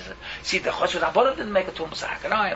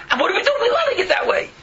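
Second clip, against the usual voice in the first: exasperated outcry; 11 semitones higher, 5 dB louder than usual. Real, not acted. Mocking outraged rhetorical question.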